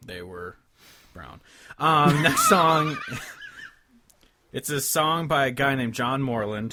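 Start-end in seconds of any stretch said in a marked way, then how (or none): 1.8-3.0 laughing through the words, loud and slow. Real, not acted. Host laughing at his own joke, loud slow laugh. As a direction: still cracking up while announcing.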